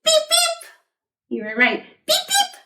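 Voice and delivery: very high toy honk; high beep beep. Pretend. Playing the toy truck, high beep beep. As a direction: chirp it up high.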